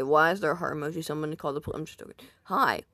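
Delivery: ordinary talk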